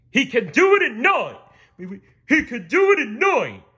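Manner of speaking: very high loud goofy boast; boastful starfish. A narrator's reading. Patrick bragging to the king, goofy loud voice. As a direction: brag without thinking. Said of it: "spoke up".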